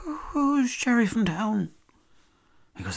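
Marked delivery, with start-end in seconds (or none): 0.1-1.7 much higher, slow baffled question. Retold or imitated. Narrator pressing the mechanic, high and slow. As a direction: squeak it out, totally lost.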